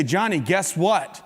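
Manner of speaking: slow, drawn-out threatening tease; menacing dad. Acted. Dad toying with the boyfriend, slow and menacing. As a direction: stretch it out like a dad about to pounce. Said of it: "I say".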